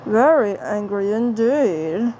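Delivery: slow, lightly menacing Martian voice; Marvin the Martian. Acted. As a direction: drag out the threat calmly. Repeats the line in Marvin voice.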